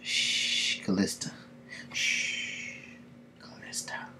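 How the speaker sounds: soft, whispered hush trailing away; whispered shushing. Acted. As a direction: shush her in a fading whisper. Character shushing the witch in story.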